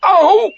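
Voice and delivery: high, loud yelp of pain; pained cry. A narrator's reading. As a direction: yelp as if pulled too hard. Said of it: "cried Pooh".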